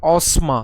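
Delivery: অসমা said with the dental s, ɔsma; অসমা pronounced ɔsma; correct pronunciation. Shown as a demonstration. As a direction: give অসমা as ɔ-sma, with a clear dental s. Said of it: "কেমন উচ্চারণ হবে?".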